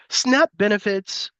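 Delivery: ordinary delivery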